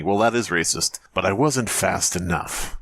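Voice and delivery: usual speaking voice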